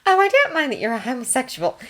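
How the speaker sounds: plain reading voice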